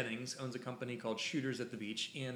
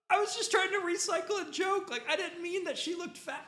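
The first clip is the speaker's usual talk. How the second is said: much higher, flustered pleading; panicked excuse; 4 dB louder than usual. Retold or imitated. Photographer scrambling to explain himself to an angry father, high panicked voice. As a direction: squeak out a desperate apology. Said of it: "And I was like".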